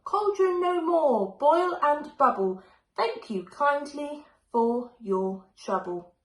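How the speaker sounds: casting a spell. A narrator's reading. Mum stopping the flood of gloop, incantation. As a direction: cast the spell with authority. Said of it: "said the right spell".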